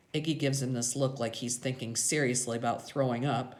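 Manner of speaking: ordinary delivery, nothing marked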